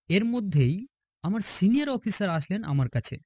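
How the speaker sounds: ordinary delivery, nothing marked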